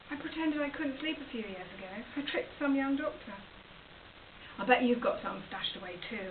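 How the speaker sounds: usual speaking voice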